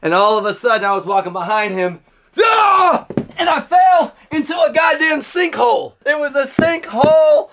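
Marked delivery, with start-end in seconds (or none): 2.4-3.1 sudden high yelp as he drops; a loud falling "ah"; startled yell. Retold or imitated. Kid falling into a sinkhole, startled yell. 3.4-7.5 high, shouted retelling. Retold or imitated. Comic retelling of falling in the sinkhole, shouted.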